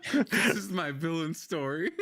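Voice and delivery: laughing through a mock dramatic line; slow, laughing villain-story quip; chuckling as you announce it. Real, not acted. Joking about his villain story.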